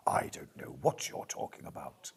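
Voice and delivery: low, hushed, teasing storybook whisper. Pretend. Host teasing a viewer about her voice, mock storybook whisper. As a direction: breathe it like a bedtime tale, playfully. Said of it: "That's a storybook voice".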